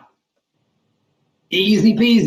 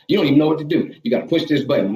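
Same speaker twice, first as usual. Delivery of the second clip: ordinary talk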